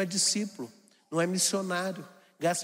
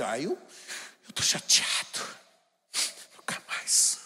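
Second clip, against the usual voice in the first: sulking whine; 7 semitones lower than usual. Retold or imitated. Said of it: "sai que sai de".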